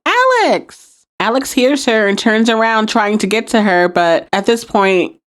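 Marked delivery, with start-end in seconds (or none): 0.1-1.1 retold yell. Recapper imitating Piper calling across the courtyard, high mock yell. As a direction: call the name long and high, as if across a yard. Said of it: "And so she yells out".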